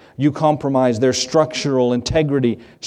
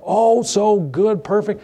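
mock gushing; 7 semitones higher, slower than usual. Mocking family's happy photo comments.

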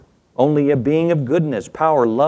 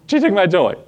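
put-on tattling child; 6 semitones higher than usual. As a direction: wail it like a wronged toddler.

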